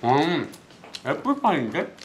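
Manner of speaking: chewing while talking; muffled through food; mouth full of pie. Real, not acted. Seen 0: Right speaker bit pie at -7 and seems to be chewing while speaking.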